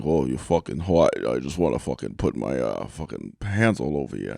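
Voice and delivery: low, soft Southie guy leering; deliberately bad Boston accent impression; bad Boston impersonation. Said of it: "It's a bad, uh, impersonation".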